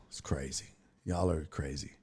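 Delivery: whispered, hushed reaction; hushed aside. Real, not acted. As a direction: breathe it out under your breath.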